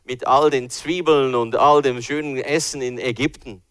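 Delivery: grumbling Israelites. Retold or imitated. Israelites moaning about manna in the desert, loud whining. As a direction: moan longingly about the food back home. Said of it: "Und immer und immer wieder".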